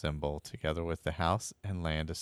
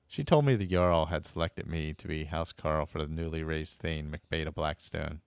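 normal talking voice

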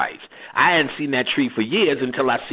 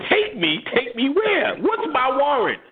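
high, alarmed protest; indignant; 13 semitones higher than usual. Retold or imitated. Man being taken to jail, high indignant. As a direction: protest the arrest in disbelief.

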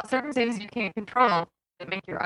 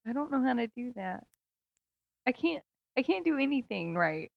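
soft, higher, self-pitying whimper; mock pitiful; 5 semitones higher, 11 dB quieter than usual. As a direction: sulk it back, quietly wounded. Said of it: "Just talk like a normal person".

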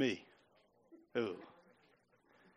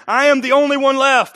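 Elijah's complaint; 6 semitones higher, 9 dB louder than usual. Retold or imitated. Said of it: "He replied".

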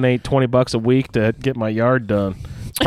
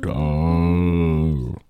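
much lower, very slow; low drawn-out echo; 7 semitones lower, much slower than usual. Pretend. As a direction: drag the word out deep. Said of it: "I have Trace Preguntas for you".

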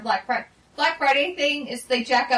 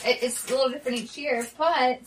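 ordinary delivery

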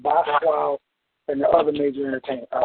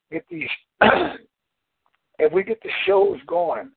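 choking, with a throat-clearing cough; 13 semitones higher than usual. Real, not acted. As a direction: talk through a choke, then clear the throat. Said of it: "Over here, I'm choking".

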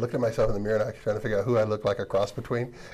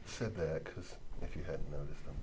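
much softer, lower throwaway; quiet aside; 8 semitones lower, 14 dB quieter than usual. Real, not acted. Host admitting the one-knee setup, muttered quiet aside. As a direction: drop it under your breath as a sheepish aside. Self-deprecating aside to audience.

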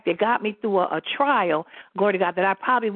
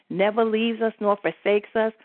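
usual speaking voice

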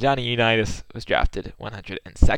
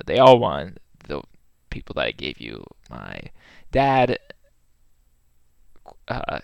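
ordinary talk

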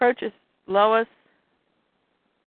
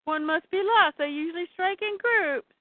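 mock scream; 7 semitones higher than usual. Granny warning the group of a dredge worm, mock scream. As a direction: cry out in a high, raised pitch. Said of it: "And Granny screams".

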